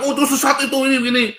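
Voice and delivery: a hint of a high, whiny mocking voice; lightly sneering imitation of squabbling believers; mock accuser.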